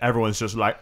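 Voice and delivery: ordinary delivery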